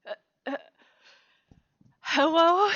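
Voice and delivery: loud rising hello; hesitant hello. Real, not acted. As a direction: call out, unsure if anyone hears. Checking if mic works.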